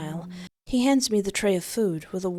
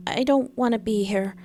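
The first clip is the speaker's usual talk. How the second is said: pleading; 5 semitones higher than usual. Acted. Pleading captive.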